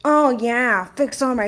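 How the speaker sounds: playful celebrity-style parody; mock sultry voice, cut short; Mingy Coolidge. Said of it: "Mingy Coolidge".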